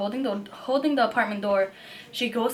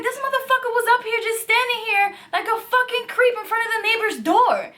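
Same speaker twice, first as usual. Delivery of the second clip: retold yell; 11 semitones higher than usual. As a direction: yell it out like the roommate did. Roommate retold yelling at stranger.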